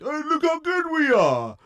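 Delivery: high sarcastic mock voice. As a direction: send up their smugness. Mocking the club's self-praise.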